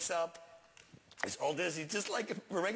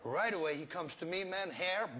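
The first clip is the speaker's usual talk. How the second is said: ordinary talk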